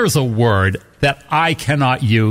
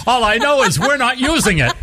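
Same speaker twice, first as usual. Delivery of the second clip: high voice through laughter; laughing; 8 semitones higher than usual. Real, not acted. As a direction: say it while laughing. Laughing after the swole joke.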